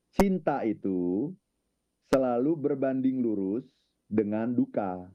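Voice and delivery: slow, weighty, each phrase set apart; slow emphatic statement. Real, not acted. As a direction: lay down the rule slowly, pausing between phrases. States a law of nature. (diucapkan pelan dan tegas, jeda tiap frasa)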